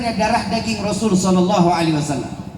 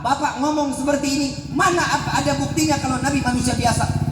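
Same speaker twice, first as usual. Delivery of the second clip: usual speaking voice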